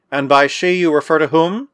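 ordinary delivery

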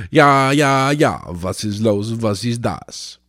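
hammed-up German accent, clipped "ja, ja"; mock German. Vlogger breaking into fake German after a media rant, mock German accent. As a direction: play a cartoon German officer.

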